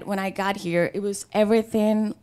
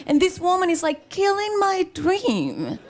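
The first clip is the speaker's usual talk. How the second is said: mock outrage; 9 semitones higher than usual. Real, not acted. Complaining about the lawyer to the audience, mock outrage. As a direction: wail it in mock despair, pitched up.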